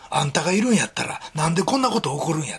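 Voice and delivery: 大阪弁で神に食ってかかる、強めに; Osaka-dialect outburst. Retold or imitated. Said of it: "大阪弁で言うと下品になるんですけど". (大阪弁で荒っぽく問い詰める)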